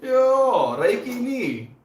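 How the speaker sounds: loud, high, drawn-out calling; mock excited hollering. Streamer calling out in a playful skit, high hollering.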